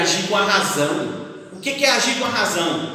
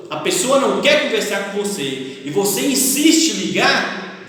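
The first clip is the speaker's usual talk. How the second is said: ordinary talk, nothing marked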